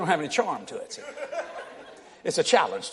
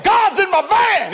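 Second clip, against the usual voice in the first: mock shout; 11 semitones higher, 7 dB louder, slower than usual. Retells shouting at the trooper.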